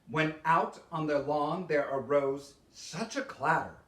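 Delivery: plain reading voice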